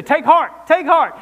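emphatic command. Acted. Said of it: "What does he say?".

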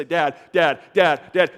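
preacher mimicking his kids calling for their distracted father; kids calling dad. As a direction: call out insistently like a child wanting attention. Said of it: "my kids will say".

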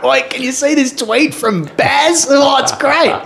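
high, slow excited Aussie voice; mock excited Gilly; Gilly impression. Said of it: "And Gilly's like".

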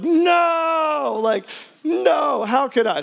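anguished high no; anguished cry. Retold or imitated. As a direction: wail it like the vase just broke. Smashing the gift pot.

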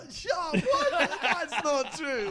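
high, laughing outcry; shocked denial. Real, not acted. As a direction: squeal it out in disbelief. Denies a false claim.

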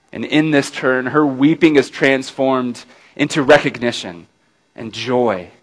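ordinary talk, nothing marked

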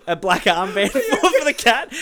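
words pushed out through laughter; can't stop laughing telling it. Real, not acted. Telling about the dead cat's armband, laughing hard.

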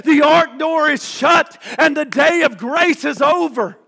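loud, high fire-and-brimstone preaching; preaching at full force. Acted. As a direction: thunder it at the congregation.

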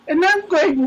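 usual speaking voice

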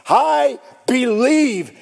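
high, loud, drawn-out cry; shrieked faith. Retold or imitated. Father shrieking out his faith, drawn-out cry. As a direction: shriek it out slowly with full force. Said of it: "by shrieking out his faith".

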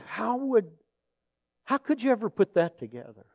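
high, soft incredulity; retold hushed astonishment at the coincidence; amazed question. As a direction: marvel quietly, pitch lifted.

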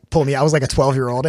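usual speaking voice

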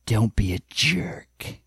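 low, slow, deep-voiced big guy; Bull impression. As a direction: drop low and drag it out like Bull. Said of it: "Please deliver it like Bull did".

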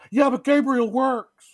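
high, loud, drawn-out mock protest; mock insistent. Acting out parents squabbling over the baby's name, mock insistent. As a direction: push back in an exaggerated spousal whine.

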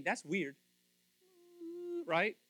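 ordinary talk

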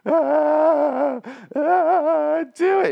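high, wailing mock sob, pleading to die; Negan pathetic begging. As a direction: beg and wail like a broken man. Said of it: "gets really sad and pathetic".

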